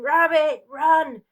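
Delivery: loud, high frantic cry to run; a mother screams at her daughter to flee; desperate scream. Acted. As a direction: scream it across the room. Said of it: "she screamed".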